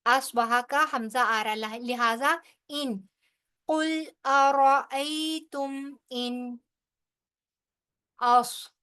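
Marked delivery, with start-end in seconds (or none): 3.7-6.6 इन with a clear, unnasalised nun before the hamza; slow chanted phrase, clear nun; tajweed recitation. Shown as a demonstration. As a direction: recite कुल अरअयतुम इन slowly, nun spoken clearly. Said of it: "इजहार होगा यहाँ पे नून साकिन का".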